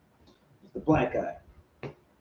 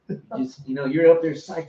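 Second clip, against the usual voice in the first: usual speaking voice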